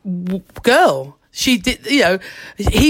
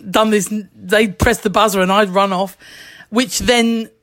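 ordinary talk, nothing marked

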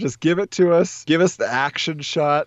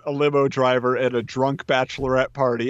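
ordinary delivery

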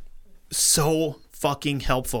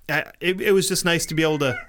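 usual speaking voice

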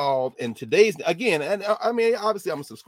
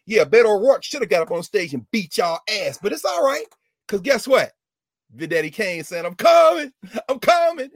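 rising shout; 7 semitones higher, 8 dB louder than usual. Real, not acted. Angry rant building to shout.

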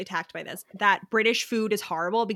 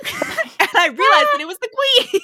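high, louder words breaking into laughter; laughing through it; 13 semitones higher, 6 dB louder than usual. Retold or imitated. Friend retelling a book scene to cohost, laughing through the quote. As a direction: giggle the punchline out.

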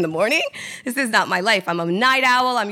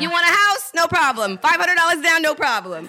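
pitched up as a pushy lender handing out loans; mock eager lender; 9 semitones higher, 3 dB louder than usual. Imitating a lender selling houses to anyone, pitched up and louder. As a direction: play the loan guy offering houses like candy. Mocking easy loan lenders.

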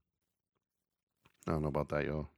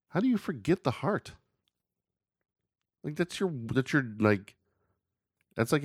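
incredulous; 9 semitones higher than usual. Real, not acted. Incredulous at forgotten heart.

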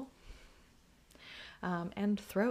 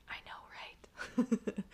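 whispered agreement; 3 semitones higher, much slower than usual. Retold or imitated. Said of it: "I was just like".

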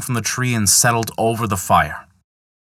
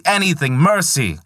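higher, begging yell; pleading shout; 8 semitones higher than usual. Acted. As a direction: plead through the pain. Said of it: "shouted Loki".

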